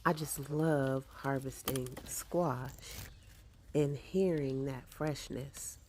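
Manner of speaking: ordinary talk, nothing marked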